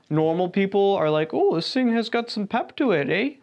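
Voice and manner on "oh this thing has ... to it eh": higher-pitched, lilting Minnesotan voice; light Minnesotan imitation with a lifted "eh"; Minnesotan aunt. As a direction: play a mildly impressed Minnesotan aunt. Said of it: "Your Minnesotan aunt is gonna think".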